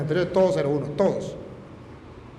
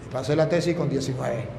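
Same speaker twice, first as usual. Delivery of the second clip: normal talking voice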